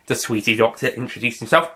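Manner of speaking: ordinary talk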